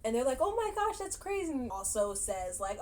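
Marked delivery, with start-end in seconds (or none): 0.4-1.5 high-pitched impressed gush; mock awed reaction, pitched up; imitated amazement. Relatives amazed at her sales.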